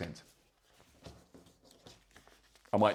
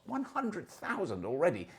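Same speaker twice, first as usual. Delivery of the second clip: pitched higher, marvelling at the number; amazed emphasis; 6 semitones higher, 4 dB quieter than usual. Real, not acted. Host marvelling at Tesla's output to listeners, amazed. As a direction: land the figure with real astonishment.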